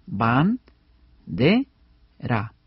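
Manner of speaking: "ban, de, ra" as three isolated syllables; measured syllable demonstration. Shown as a demonstration. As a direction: pause after each syllable, dropping on "ra". Spells bandera out by syllables.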